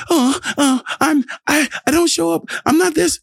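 high, whimpering mock sob; fake-blubber like someone too scared to show up; mock crying. Acting out insecure crying.

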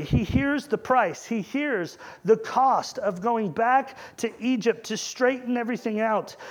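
ordinary delivery

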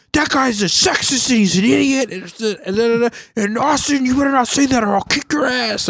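high shrill mock yell of an angry stepmom. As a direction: squeak out a scolding stepmom. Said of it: "she was yelling".

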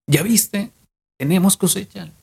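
high, eager announcement; excited news. Retold or imitated. Farmer rushes home to tell his wife about the harvest, high and excited. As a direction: burst in with the good news, pitched high.

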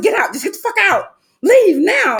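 high, loud get out; yelling him out. Retold or imitated. Woman yelling at her lover to leave. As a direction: throw him out of the house, shouting. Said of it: "I said".

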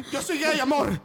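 very high, laughing excuse; mock pleading boyfriend. Boyfriend excusing himself to jealous partner, high mock plea. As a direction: squeal it as a panicked joke.

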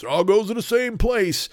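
high put-on kid voice; neighborhood kid. Poor neighborhood kid defending mixed food, high kid voice. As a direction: pipe it up like a know-it-all kid. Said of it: "those kids in your neighborhood".

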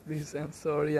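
ordinary delivery

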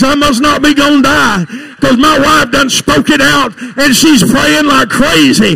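loud, high Pentecostal shouting, stretched words; full-force preaching. Real, not acted. Preacher promising the congregation the Holy Ghost, full-force shout. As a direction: preach it at the top of your voice.

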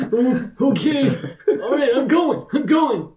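high, eager, laughing compliance; high hurried NPC. Acted. Chosen man agrees to go to the gate, high and hurried. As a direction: pitch it way up and hurry off.

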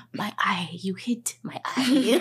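whispered imitation of a hurt husband; mock wounded whisper. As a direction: whimper it in a comic, drawn-out whisper. Acts out great-grandfather hurt in bed.

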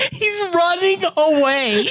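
slow words dissolving into a high laugh; can't get it out for laughing. Real, not acted. Telling friends about the ride, breaking into high laughter.

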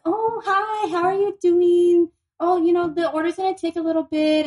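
high, sing-song customer-service voice, slowed on "how are you doing"; imitating a chatty cashier; mock flirty server.

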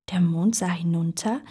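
plain reading voice

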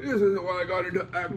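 high, put-on lament of a weary old stage actor; despairing old actor. Ian McKellen breaking down before the tennis ball, high despairing lament. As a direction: wail it like a broken-down veteran actor. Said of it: "he was like".

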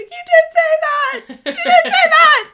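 high shrieking laughing yell, slow and very loud; laughing scream. Retold or imitated. Ashley screams at Mia, shrieking high and laughing. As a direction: shriek it through laughter, accusing. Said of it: "Ashley starts screaming".

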